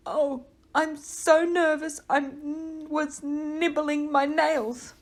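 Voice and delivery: slow, high nervous confession. A narrator's reading. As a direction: wring it out anxiously.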